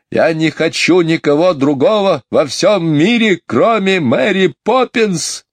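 put-on high, stubborn childish protest; wailing boy. Michael refuses anyone but his nanny, high childish wail. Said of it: "громко заревел".

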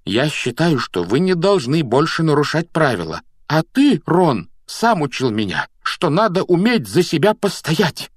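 standing up to friends; defiant arguing. A narrator's reading. Neville argues back at Ron.

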